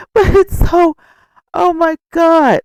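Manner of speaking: high, loud disbelief; exasperated. Real, not acted. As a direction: squeal it out in disbelief. Overwhelmed amused exclamation.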